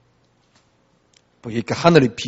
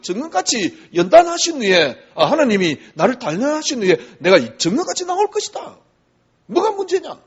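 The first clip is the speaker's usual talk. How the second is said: Job's retort; 9 semitones higher than usual. Retold or imitated. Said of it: "욥이 하는 말입니다".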